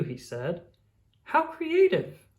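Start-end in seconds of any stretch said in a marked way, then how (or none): 1.2-2.3 high, slow, delighted "How creative!"; delighted teacher. Acted. Teacher praising Daniel's paper heart, delighted and high. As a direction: gush over the child's gift, higher.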